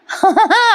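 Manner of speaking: a quick high amused laugh; a real little laugh breaking in; short laugh. Real, not acted. Laugh in the retelling. (छोटंसं खुदकन हसू)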